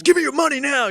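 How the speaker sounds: high, loud fake hold-up demand; mock robber's shout. Man acting out a gun drill on his friend, loud mock threat. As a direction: yell it like a pretend mugger. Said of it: "trying to be realistic".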